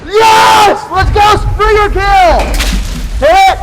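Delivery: loud, high victory yell; excited shout. Real, not acted. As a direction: cheer it out after the hit. Celebrating a kill mid-match.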